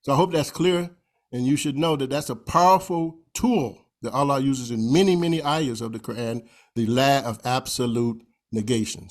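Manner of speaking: ordinary delivery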